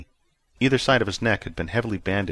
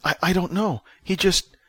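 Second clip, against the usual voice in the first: high, louder, stammering reply; flustered; 11 semitones higher, 3 dB louder than usual. A narrator's reading. Joey answers frantic June, flustered.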